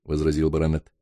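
plain reading voice

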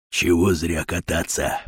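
low, slow, hushed voice; murmured whisper. Acted. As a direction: say it slowly under your breath. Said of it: "прошептал Батон". (тихо, низко, почти шёпотом)

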